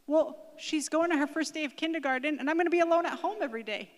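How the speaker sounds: put-on high, loud childlike complaint; upset child explaining. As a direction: pour out the worry as a small boy would.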